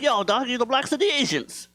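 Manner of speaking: high mock street-buddy voice; imitating thug friend. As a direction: pitch it up as a thug pal. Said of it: "my thug friends".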